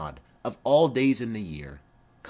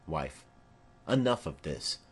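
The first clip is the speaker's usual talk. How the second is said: usual speaking voice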